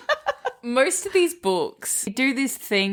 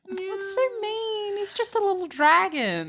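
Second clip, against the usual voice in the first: pitying coo; 13 semitones higher than usual. Retold or imitated. Feeling sorry for the small dragon, high pitying coo. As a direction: coo over the little dragon. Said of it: "I was like".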